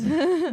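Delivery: a short high laugh breaking out; bright burst of laughter. Real, not acted. Laughing at her own taco story, short laugh.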